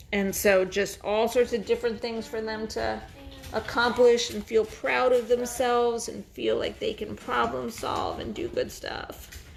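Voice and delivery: ordinary talk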